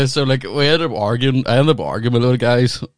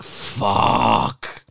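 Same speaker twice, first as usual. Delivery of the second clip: dismayed whisper; 8 semitones lower than usual. Retold or imitated. Realising hotel is eight miles.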